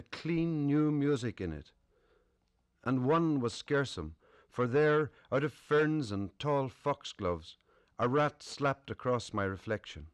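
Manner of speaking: measured poem recitation with weighted phrases; deliberate, lifted poetry-reading voice. Acted. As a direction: recite it line by line, pausing between phrases. Reads own poem to audience.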